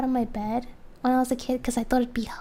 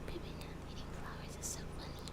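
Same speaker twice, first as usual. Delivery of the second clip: whispered chat read; whispering; 22 dB quieter, faster than usual. Real, not acted. Reading chat aloud to viewers, whispered. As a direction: read it under the breath.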